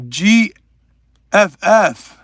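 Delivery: usual speaking voice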